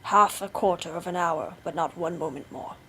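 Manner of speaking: low, unyielding husband voice; stern Bluebeard. A narrator's reading. Bluebeard grants a short delay.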